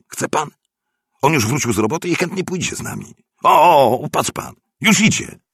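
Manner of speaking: high, eager, persuasive character voice; excitable neighbour. A narrator's reading. (wysoki, podekscytowany głos namawiającego sąsiada)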